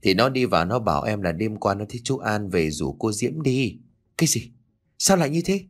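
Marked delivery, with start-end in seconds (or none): 4.2-5.6 higher, sharp startled question; a jolt of disbelief. Acted. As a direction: caught off guard by strange news. Husband hears startling news.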